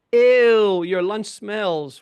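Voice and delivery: a loud, high "Ew" dragged out slowly in disgust; disgusted kid. Retold or imitated. Little schoolgirl mocks daughter's smelly lunch, loud disgusted jeer. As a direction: mimic a little girl's grossed-out jeer.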